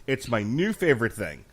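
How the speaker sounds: ordinary talk, nothing marked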